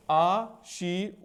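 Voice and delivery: "आ शी" very slow, each syllable drawn out; syllable-by-syllable demonstration. Shown as a demonstration. Teacher builds the word for students, "आ शी" very slowly. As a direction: stretch "आ शी" as two separate long syllables. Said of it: "ऊपर फिर रा पढ़ेंगे".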